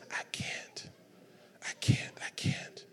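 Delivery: very soft, voiceless whisper; whispered Jesus. Acted. Jesus repeating his answer to Hagin, whispered. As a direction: breathe it out as a hushed whisper. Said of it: "the Lord whispers and says".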